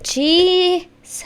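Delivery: slow, high swooning sigh. Real, not acted. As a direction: swoon over the words. Gushing over members.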